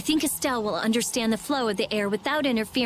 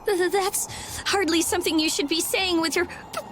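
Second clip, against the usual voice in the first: shivering stammer; 6 semitones higher than usual. Acted. Freezing with purple lips.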